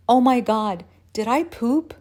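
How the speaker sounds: urgent, embarrassed questioning; embarrassed alarm. Acted. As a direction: blurt it out, mortified. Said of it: "I was so embarrassed".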